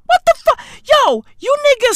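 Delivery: squeaky high start, dragging out as it drops; baffled disbelief. Acted. Comedian criticising how Merseyside sounds, high-pitched disbelief.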